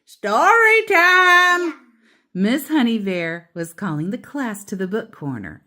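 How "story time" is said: booming, drawn-out cheerful call, much higher; hearty sing-song shout; loud jolly call. A narrator's reading. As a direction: call the whole class over, big and slow. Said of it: "a loud, jolly voice called out".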